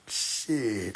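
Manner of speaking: usual speaking voice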